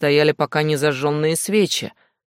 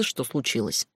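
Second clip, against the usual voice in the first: usual speaking voice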